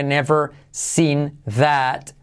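"never seen that" stretched very slowly, word by word; slow sound-by-sound demo. Shown as a demonstration. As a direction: draw out "never", "seen", "that" separately. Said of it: "clave R". ("never seen that" muy lento, separado)

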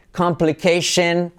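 usual speaking voice

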